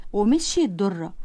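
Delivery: ordinary delivery, nothing marked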